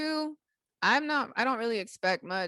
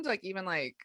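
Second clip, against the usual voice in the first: normal talking voice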